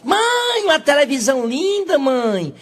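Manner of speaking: put-on excited boy. Boy excitedly telling mother.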